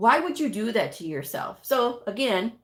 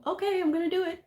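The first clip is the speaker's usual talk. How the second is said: put-on cheeky kid; 9 semitones higher, much faster than usual. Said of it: "exactly what the kid's gonna do".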